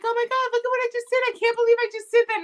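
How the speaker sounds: high, fast and breathless with amazement; mock giddy pride. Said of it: "you're gonna be like".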